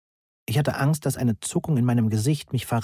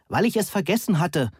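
higher-pitched raised voice, not a full shout; gemäßigtes Rufen; 8 semitones higher than usual. A narrator's reading. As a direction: call over singing at a restrained volume. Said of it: "Ich musste schreien, um den Gesang zu übertönen". (hoch angesetztes, gemäßigtes Rufen)

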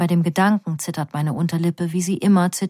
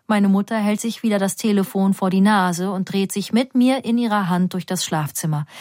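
plain reading voice

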